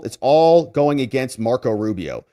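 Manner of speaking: ordinary delivery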